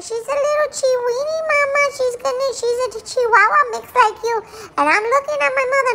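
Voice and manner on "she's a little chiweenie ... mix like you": lightly higher cooing take on Mom; imitating Mommy. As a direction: coo it like Mom excusing the puppy. Dog voicing Mommy.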